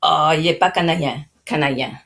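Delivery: put-on old-timer Quebec French, canadien said as canadjen. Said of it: "they would say".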